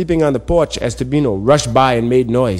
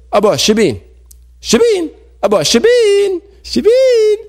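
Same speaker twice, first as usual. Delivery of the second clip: calling out. Acted. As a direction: sing the name up to someone in a tree. Calling the monkey up the tree.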